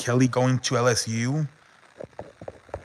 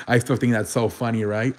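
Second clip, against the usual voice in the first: normal talking voice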